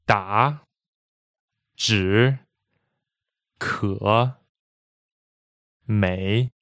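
DA, ZHI, KE, MEI each dipping, then rising; third tone. Shown as a demonstration. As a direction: separate dip-and-return on "da, zhi, ke, mei". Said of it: "they all have that slight vibration".

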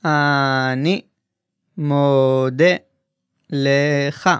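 אני מודה לך said slowly, word by word; slow model phrase. Shown as a demonstration. Teacher modeling the male form slowly for learners. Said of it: "I thank you" is".

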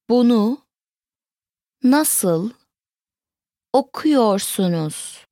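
slow, word-by-word teaching model; broken down. Shown as a demonstration. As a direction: say it word by word with long pauses. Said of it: "Let's break it down".

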